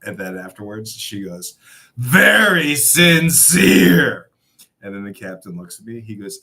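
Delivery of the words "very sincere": very slow, high, loud sarcasm; sarcastic drawl. Retold or imitated. The employee mocking his apology, slow high sarcasm. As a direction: stretch it out mockingly.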